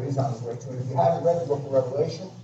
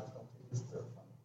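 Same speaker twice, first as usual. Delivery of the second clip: whispered plea; 22 dB quieter, faster than usual. Acted. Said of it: "like David said".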